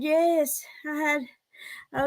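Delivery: usual speaking voice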